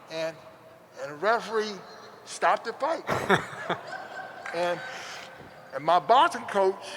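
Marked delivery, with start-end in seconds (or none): 3.1-4.5 soft, airless chuckle; breathy laugh. Real, not acted. Laughs at the crying kid.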